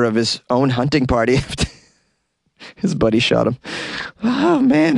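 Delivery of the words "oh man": amazed oh man. Real, not acted. Reacting to buddy shooting him.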